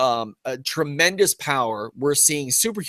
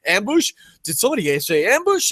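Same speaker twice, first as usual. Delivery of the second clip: raised-pitch startled question; mock alarm; 7 semitones higher, 3 dB louder than usual. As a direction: imitate fighters pricking up at a cue. Acting the crowd's reaction to yelling.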